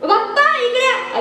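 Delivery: high-pitched calling out to relatives; acted shouting. Son calling aunts and father over, shouting. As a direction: holler for everyone to come over. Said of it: "अरे, ओरडायला काय झालं?".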